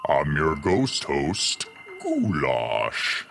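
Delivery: low, slow ghostly host voice; spooky ghost host. Acted. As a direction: drawl it like a cartoon ghost. Ghost host opening the show.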